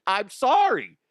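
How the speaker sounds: ordinary delivery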